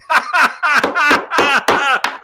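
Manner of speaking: high, loud cackling laugh; big laugh. Real, not acted. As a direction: burst out laughing at your own joke. Laughing at own joke.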